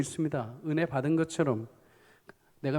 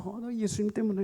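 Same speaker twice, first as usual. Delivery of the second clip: soft, higher-pitched simple reply; gentle answer; 5 semitones higher, 6 dB quieter than usual. Shown as a demonstration. Believer answering why they still laugh despite hardship, soft and gentle. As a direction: drop the volume and answer lightly. Modelled answer to a second question.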